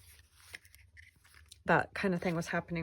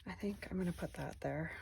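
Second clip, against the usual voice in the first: whispering; 11 dB quieter than usual. Real, not acted. Muttering to self while crafting.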